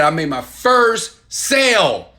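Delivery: the proud moment of a first sale. Retold or imitated. A viewer announcing a first sale online, slow and proud. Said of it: "And they said".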